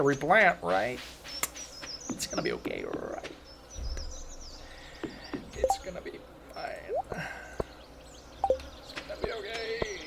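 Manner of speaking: whispered nervous self-reassurance; nervously reassuring myself. Real, not acted. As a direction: whisper to calm yourself down. Panic after neglecting the farm.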